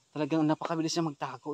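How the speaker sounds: ordinary talk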